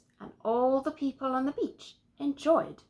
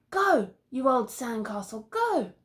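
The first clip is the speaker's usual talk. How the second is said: shouty cheer; 3 semitones higher, 5 dB louder than usual. Acted. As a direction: yell them on. Shouty Arthur cheering sandcastle.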